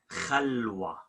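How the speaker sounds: khalwa with a throaty rasped kh; spoken kh. Shown as a demonstration. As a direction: softly say khalwa with rough ch. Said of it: "So it's gonna be".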